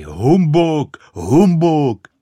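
"Humbuk" yelled twice, high and very loud; výkřiky z plna hrdla. A narrator's reading. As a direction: shout "Humbuk" at the top of your lungs. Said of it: "z plna hrdla vykřikovali". (z plna hrdla vykřikovat „Humbuk“)

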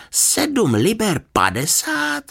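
very high, drawn-out astonished question; incredulous. A narrator's reading. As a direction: stretch it out in stunned disbelief. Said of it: "nemohl uvěřit svým uším". (ohromeně, vysoko a protáhle)

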